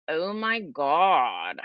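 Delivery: put-on long-drawn Valley Girl exclamation; Valley Girl accent. Shown as a demonstration. Demonstrating for viewers, exaggerated Valley Girl accent. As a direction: stretch each word out, full Valley Girl. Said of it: "that's Valley Girl".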